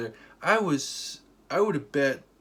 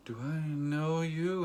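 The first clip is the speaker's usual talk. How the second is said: higher, slow drawn-out question; puzzled suspicion; 4 semitones higher, 4 dB quieter, much slower than usual. Retold or imitated. Girl asking the stranger at the counter, slowly puzzled. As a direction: draw it out as if trying to place someone.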